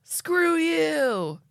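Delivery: angry dad voice. Acted. Showing how an angry dad sounds, in a mock shout. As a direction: snap it like a fed-up father. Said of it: "What's an angry dad voice?".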